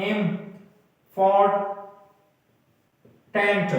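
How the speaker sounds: "एम... फॉर... tent" with long pauses between; syllable by syllable. Shown as a demonstration. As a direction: break it into three isolated beats, "एम, फॉर, tent". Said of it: "ये कितने syllable से बना है?". (एम, फॉर, tent अलग-अलग, रुक-रुक कर)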